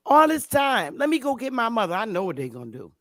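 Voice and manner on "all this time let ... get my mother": imitated excited local. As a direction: play the stunned neighbor calling family. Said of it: "they say".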